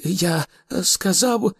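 high, slow, timid insistence; trembling voice. A narrator's reading. Arthur repeats himself to Thor, trembling. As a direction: repeat it while trying to hide fear. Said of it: "повторив Артур тремтливим".

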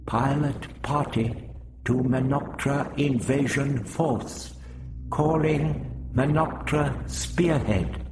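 calling an invasion force over a crackling set; radio call. A narrator's reading. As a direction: hail the fleet over the radio. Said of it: "Vrestin began calling".

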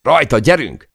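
high, loud cry to push on; urging the crew on with a loud shout; rallying shout. Acted. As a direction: yell to get everyone moving. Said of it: "kiáltottam".

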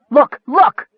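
high, urgent repeated shout; savage cry. A narrator's reading. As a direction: force them to look, fiercely. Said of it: "Michael cried savagely".

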